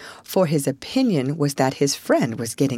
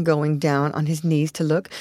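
ordinary delivery

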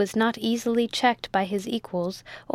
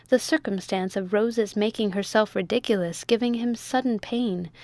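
plain reading voice

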